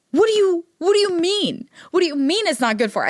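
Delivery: mock-indignant "what do you mean"; acted disbelief. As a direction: challenge the friend as if you can't believe it. Retells her disbelieving reaction to friend.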